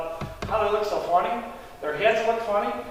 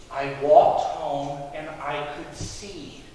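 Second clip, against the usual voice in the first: slow, lowered, measured recital; weary retelling; 6 semitones lower, much slower than usual. Acted. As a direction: recite it slowly, as often asked. Weary repeat of his testimony.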